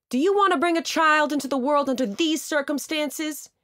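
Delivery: high, loud challenge to the crowd; shouted. A narrator's reading. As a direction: proclaim it for the whole park to hear. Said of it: "Richard shouted".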